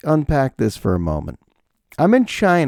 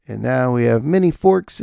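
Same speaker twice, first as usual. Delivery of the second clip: ordinary talk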